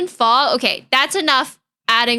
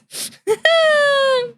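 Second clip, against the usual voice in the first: high squealing laugh; 13 semitones higher than usual. Real, not acted. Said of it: "high laugh".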